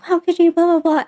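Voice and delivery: high-pitched mock yelling; imitated yelling. Teller imitates the girl yelling at her date, mock yell. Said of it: "just, like, yelling at him".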